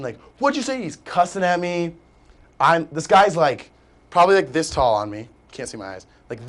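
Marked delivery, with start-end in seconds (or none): 0.4-0.8 mock scream.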